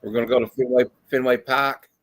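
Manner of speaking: put-on rough Boston accent, playful. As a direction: drop the r's like a Bostonian. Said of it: "that's my worst Boston accent".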